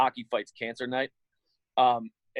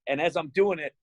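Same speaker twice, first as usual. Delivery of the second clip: usual speaking voice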